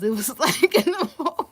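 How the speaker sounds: high, loud words breaking up with laughter; real laughing. Real, not acted. Reader explaining her reaction in the moment, helplessly laughing. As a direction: crack up mid-sentence.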